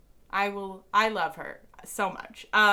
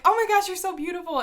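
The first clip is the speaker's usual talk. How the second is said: high, louder gush; 11 semitones higher, 6 dB louder than usual. Retold or imitated. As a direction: swoon over her idol. Haley gushing at Taylor.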